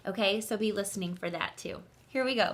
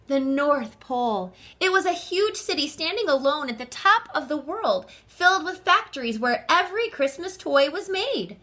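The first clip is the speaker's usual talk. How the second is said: excited narration; 6 semitones higher, 6 dB louder than usual. A narrator's reading.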